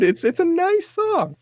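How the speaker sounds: high, slow sarcastic praise; mock sincere. Host sarcastically praises the Gary, Indiana song in a high mock-sweet voice. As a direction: gush about the song with obvious irony.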